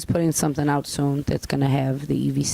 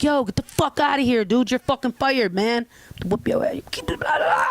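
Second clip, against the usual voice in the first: higher, forceful, cursing boss; aggressive firing; 8 semitones higher than usual. Shown as a demonstration. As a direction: blow up at the thief, punch the curse words. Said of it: "It's a difference, an inflection".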